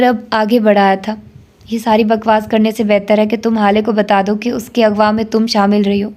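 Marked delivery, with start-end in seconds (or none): none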